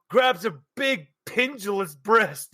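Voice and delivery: ordinary delivery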